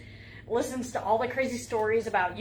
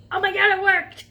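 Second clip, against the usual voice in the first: excited; 9 semitones higher, 10 dB louder than usual. Real, not acted. Excited it works, loud high.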